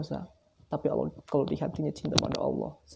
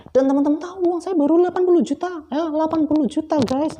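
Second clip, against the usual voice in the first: much higher and louder, stunned at the amount; shocked disbelief; 7 dB louder than usual. Real, not acted. Telling viewers his savings, high stunned voice. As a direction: blurt out the tiny sum in disbelief.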